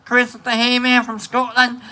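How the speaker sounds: ordinary delivery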